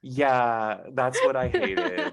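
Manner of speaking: drawn-out, laughing mock admission; sarcastic agreement. Podcast host humoring a co-host's teasing accusation, sarcastically agreeing. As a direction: play along with the tease, slowly and loudly.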